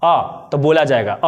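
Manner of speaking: "अ" said slowly with the mouth open, lips apart; open-mouth a demo. Shown as a demonstration. Teacher showing students an open-mouthed "अ". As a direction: open the mouth wide and hold "अ" low and slow. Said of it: "बिना मुंह सटाए बोलिए".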